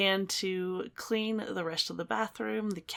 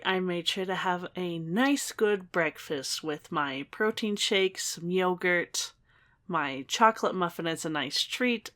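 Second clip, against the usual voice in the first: bright, steady voiceover read; voiceover narration; 5 dB louder than usual. Acted. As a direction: narrate the morning routine like a vlog voiceover. Voiceover narration of morning routine.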